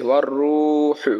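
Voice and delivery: ওয়ার রূহু slowly, with the rū drawn out; slow recited রূহু with a held first vowel; one-alif stretch. Shown as a demonstration. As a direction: lengthen the u of রূ in রূহু, keep the last vowel short. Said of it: "রু কিন্তু আমরা এক আলিফ টানতেছি".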